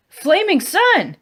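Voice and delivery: high, loud, slow alarmed cry; startled shout. Acted. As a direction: bellow in sudden fright. Startled man wakes beside bear.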